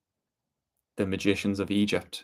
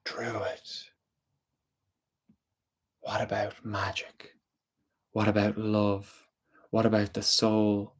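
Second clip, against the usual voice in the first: softer, slower questioning spirit; hushed spirit; 5 dB quieter, slower than usual. A narrator's reading. Water spirit quietly asking the druid about magic and love, hushed. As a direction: coax him with quiet questions.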